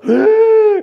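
loud high gasp; sharp gasp. Acted. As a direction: gasp in shock. Mock shock at the accusations.

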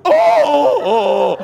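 loud, high yelp of a man knocked down; man on video yelling as he's rammed; shocked cry. Retold or imitated. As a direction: cry out as the sheep hits you. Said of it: "you hear the guy on the video go".

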